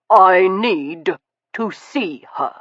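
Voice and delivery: slow, clipped, upper-class words; clipped aristocratic. Acted. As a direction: bite off each word with cold breeding. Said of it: "clipping the words in his most aristocratic tones".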